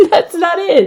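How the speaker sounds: high-pitched laughing admission of failure; helpless giggle while speaking. Real, not acted. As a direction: burst out laughing through the words. Laughing at own failed accent.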